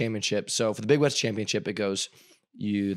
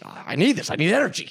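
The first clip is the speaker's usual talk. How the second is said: desperate plea; 7 semitones higher than usual. Retold or imitated. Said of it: "I'm like".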